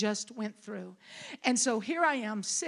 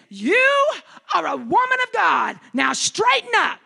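loud and high, firm rebuke; stern command; 8 semitones higher, 13 dB louder than usual. Retold or imitated. Sister-in-law rebukes the grieving minister, stern loud command. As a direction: snap her out of it. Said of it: "She said".

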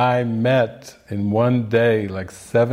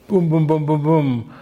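ordinary talk

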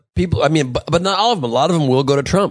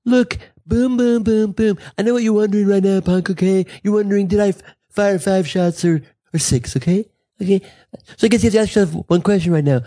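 higher-pitched blustering candidate impression; Donald Trump; 6 semitones higher than usual. Host as the president facing down ISIS, blustering impression. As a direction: play a tough-guy president, pitched up. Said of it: "he'd be like".

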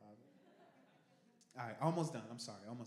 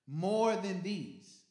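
more than these, loud and very slow; drawn-out emphasis; 5 semitones higher, 12 dB louder, much slower than usual. Acted. Jesus pressing Peter, slow and loud. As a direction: stretch every word of more than these.

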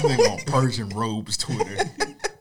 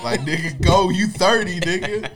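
loud laughing shout, higher; laughing mock yell; 7 semitones higher, 6 dB louder than usual. Mock yelling at villain.

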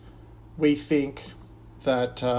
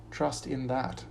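soft refrain; 7 dB quieter than usual. Acted. Pastor closing each verse with a soft refrain. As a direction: drop to a soft, settled close.

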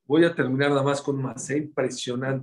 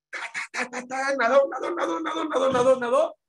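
excited storytelling; 10 semitones higher than usual. A narrator's reading. Father swimming to save son.

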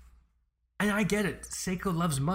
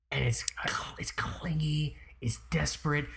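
whispering; 3 dB quieter than usual. Real, not acted. Whispered disdain.

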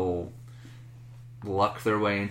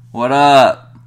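loud greeting; 3 semitones higher, 14 dB louder than usual. Real, not acted. Greeting caller loudly.

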